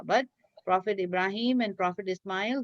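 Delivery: ordinary talk, nothing marked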